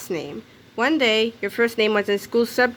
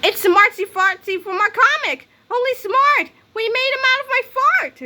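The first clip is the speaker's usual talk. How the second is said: much higher, bright excitement; thrilled cheering; 12 semitones higher, 3 dB louder than usual. Acted. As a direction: crow with pride. Kids cheering their creation.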